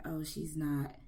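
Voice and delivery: a hint of a nitpicking linguist; picky linguist. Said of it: "listened to you and said".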